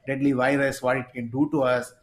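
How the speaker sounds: usual speaking voice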